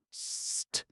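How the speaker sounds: sharp whispered sst; hissed warning. Acted. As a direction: hiss it sharply under your breath. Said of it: "She hissed a warning in a sharp undertone".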